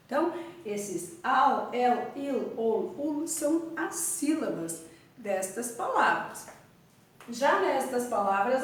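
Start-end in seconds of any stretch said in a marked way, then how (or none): none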